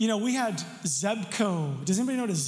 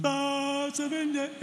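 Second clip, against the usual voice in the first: Lion King moment; 6 semitones higher, much slower than usual. Retold or imitated. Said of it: "one of those Lion King moments".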